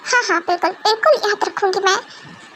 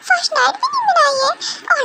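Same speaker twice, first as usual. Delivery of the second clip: dramatic lament; 9 semitones higher than usual. Acted. Girl lamenting unmet dreams.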